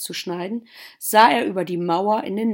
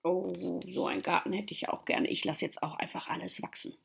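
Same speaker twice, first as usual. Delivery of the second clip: very soft, near-whispered musing; hushed aside; 12 dB quieter than usual. Real, not acted. Storyteller wishing for such a garden, hushed aside. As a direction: murmur it wistfully, as if to yourself.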